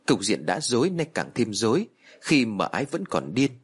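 ordinary delivery, nothing marked

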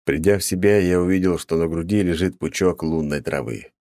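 ordinary delivery

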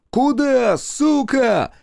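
raging shout; angry yell. A narrator's reading. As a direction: bellow after the fleeing boy. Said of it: "заорали с деревенской улицы".

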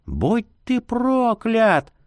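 high, slow sobbing scream; crying curse. A narrator's reading. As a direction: curse him through tears. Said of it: "кричит, захлёбываясь слезами".

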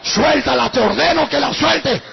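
loud, forceful order; exorcist's command. Retold or imitated. Pastor orders the demon to release her, loud command. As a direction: order it out with full authority.

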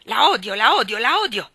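very loud, stamping hate; furious repetition. Acted. Anna stamps and shouts her hatred at Rachel Lynde. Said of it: "ripeté picchiando il piede".

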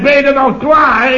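high mock wife calling through a door; wife calling through the bathroom door, high voiced. Doemie quoted at door.

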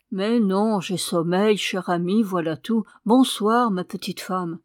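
plain reading voice

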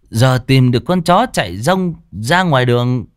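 put-on drunk slurring. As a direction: drag the words like a drunk. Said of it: "Nó tiếp tục cái giọng lè nhè".

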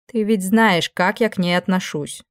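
ordinary delivery

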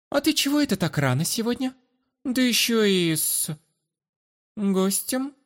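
put-on playful young woman. Sister teasing brother.

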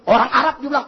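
usual speaking voice

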